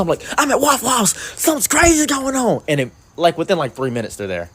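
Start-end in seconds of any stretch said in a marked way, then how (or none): 0.4-2.6 much higher, urgent mock-panicked voice; panicked caller. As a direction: squeak it out like a frantic caller. Panicked call to the cops.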